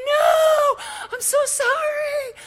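high, loud no, then slow sorry; mock horrified apology. Contractor apologizing to the wife, high mock-horrified yelp.

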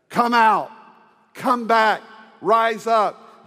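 loud, high, slow summons; commanding shout. Acted. Jesus shouts to Lazarus at the tomb, loud and commanding. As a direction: call the dead man out with authority. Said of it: "Jesus shouts it".

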